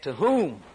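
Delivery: WHOM leaned on and stretched out; "whom" emphasized as the key word; stress on WHOM. Shown as a demonstration. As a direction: put the weight on "whom". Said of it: "the emphasis here, look at it".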